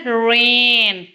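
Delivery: रेन with a long stretched ए; longer vowel sound. Shown as a demonstration. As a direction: slowly draw out the vowel in रेन. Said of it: "sound जो है वो longer". (रेन में ए लंबा खींचकर)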